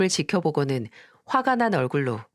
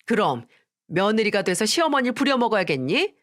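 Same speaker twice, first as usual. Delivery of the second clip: higher, sharper scolding that climbs at the end; higher furious threat; angry mother-in-law; 3 semitones higher than usual. Acted. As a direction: threaten her, voice rising. Mother-in-law keeps yelling.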